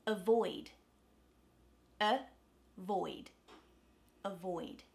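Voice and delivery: Avoid with lax uh first syllable; schwa in avoid. Shown as a demonstration. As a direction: say uh-void slowly. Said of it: "repeat it".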